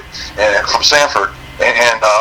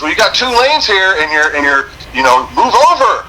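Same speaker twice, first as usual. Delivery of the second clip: yelling trooper; 7 semitones higher than usual. Retold or imitated. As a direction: shout across lanes like an annoyed trooper.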